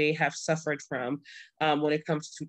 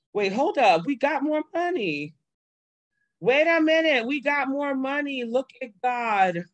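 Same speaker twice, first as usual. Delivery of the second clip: high, loud burst of joyful disbelief; shouting with real joy at the news; excited yelps of surprise; thrilled surprise; 7 semitones higher, 3 dB louder than usual. Real, not acted. Sees new GoFundMe donations live.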